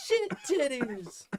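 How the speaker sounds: squeaky-high, drawn-out punchline; high-pitched repeat. Retold or imitated. Dad savouring his kid's words, high drawn-out voice. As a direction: say it slowly, way up high.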